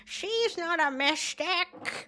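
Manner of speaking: squeaky, slow mock voice with a laugh; high mocking voice. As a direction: play a snippy critic mocking her. Mock comment on herself.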